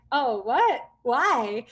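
loud, startled "what" rising high; shocked. Retold or imitated. To the girl in the club, loud startled disbelief. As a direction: jolt up as if caught off guard.

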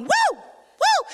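high-pitched mock whoop, twice; hyper-spiritual whoop. Preacher mimics overeager marcher at Jericho, whooping. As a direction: let out two high, overexcited woos. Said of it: "hyper spiritual person going".

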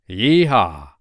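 whooping cowboy holler; cowboy yeehaw. Acted. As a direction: let out a loud rodeo whoop. Narrator cheers cowboy rescue.

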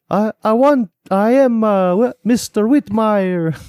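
very high, loud mock-Italian voice; high-pitched Italian parody, mimicking someone; bad Italian accent. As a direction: ham up a terrible Italian accent at top pitch. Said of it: "high pitch, like, Italian".

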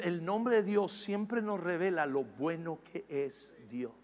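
normal talking voice

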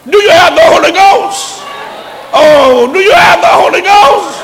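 high, loud repeated challenge to the church; shouted question. Real, not acted. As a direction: hammer the question home at full voice. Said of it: "repeated shouted question to congregation".

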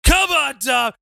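high, shouted challenge; taunting shout. Acted. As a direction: call him out across the ring. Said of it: "Ray Raptor's just standing there".

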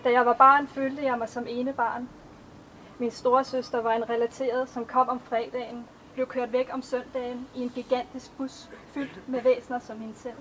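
measured, even poetry recitation; a poet reading her own verses to an audience; poem reading. Acted. Author reads her poems aloud.

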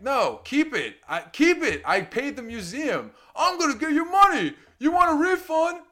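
loud, high angry shouting; shouting match. Retold or imitated. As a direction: shout him down in the museum. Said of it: "I'm like".

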